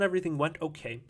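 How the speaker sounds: ordinary delivery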